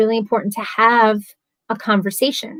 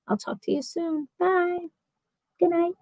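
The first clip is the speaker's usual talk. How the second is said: soft sign-off; 7 semitones higher, 8 dB quieter than usual. Real, not acted. Signing off the livestream.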